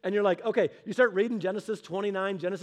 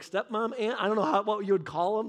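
ordinary talk, nothing marked

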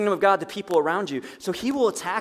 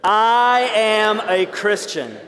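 very slow, louder declaration; said together, stronger; 5 dB louder, much slower than usual. Shown as a demonstration. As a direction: proclaim it slowly and boldly. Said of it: "Let's say it together".

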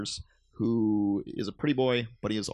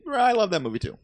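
eager agreement; 11 semitones higher, 8 dB louder than usual. Real, not acted. Eager agreement about The Matrix.

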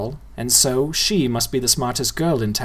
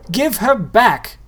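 put-on higher, slow insistent boy; insistent repeat; 9 semitones higher, 4 dB louder, slower than usual. A narrator's reading. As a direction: say it again, stubbornly. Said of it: "Nobody repeated".

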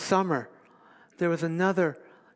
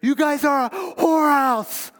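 shouted rebuke, much higher and louder, slowing on you are evil; fiery preaching; 10 semitones higher, 8 dB louder than usual. Retold or imitated. Interim pastor denouncing the English ministry, furious shout. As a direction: thunder it at the congregation like a hellfire preacher. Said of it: "So I would say".

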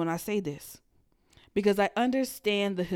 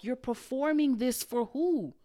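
usual speaking voice

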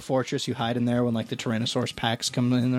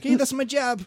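high, indignant blue-collar guy; high welder voice; 13 semitones higher than usual. Retold or imitated. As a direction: play the offended welder, pitched way up. Voicing an offended welder.

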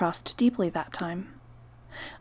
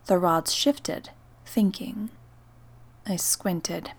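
plain reading voice

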